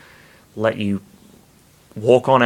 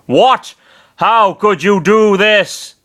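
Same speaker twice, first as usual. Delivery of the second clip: higher, louder furious demand; rage; 9 semitones higher, 5 dB louder, slower than usual. Acted. Bernard rages at his escaped hostage, furious. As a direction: rage at the betrayal. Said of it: "asked in rage".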